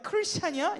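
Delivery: high-pitched sneering question; sarcastic. Retold or imitated. Preacher imitating today's jibes at Christians, sarcastic. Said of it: "이런 비꼬는 말".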